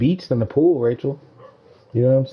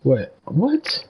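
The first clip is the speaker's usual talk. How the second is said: incredulous what; 3 semitones higher than usual. Real, not acted. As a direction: react in disbelief, pitched way up. Shock at six-hour wait.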